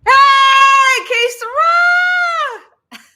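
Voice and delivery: delighted high yell of a name; greeting a friend who just showed up with a delighted high shout; excited shout. Real, not acted. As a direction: shout her name with joy, stretched out. Greeting a viewer who joined.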